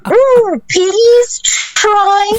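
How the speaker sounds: hammed-up, very high plea; actressy overdone squeal of a plea; overdone high pitch. Shown as a demonstration. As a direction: beg in an exaggerated high-pitched voice. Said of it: "I may have overdid it just a little bit".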